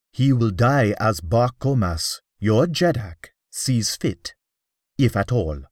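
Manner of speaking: lightly voiced young ruler, measured and firm; emphasis and dignity. A narrator's reading. The young Jeddak answering his lieutenant, firm and dignified. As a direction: lay down the law with calm dignity. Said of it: "with emphasis and dignity".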